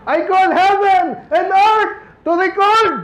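Moses' call, shouted high and slow; proclaiming shout. Acted. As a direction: proclaim it like a prophet crying out. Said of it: "sumisigaw na rin siya eh". (malakas na sigaw ni Moses)

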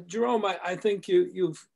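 ordinary talk, nothing marked

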